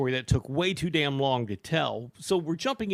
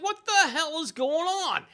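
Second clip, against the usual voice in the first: retold confused outburst; 11 semitones higher than usual. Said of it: "And I'm like".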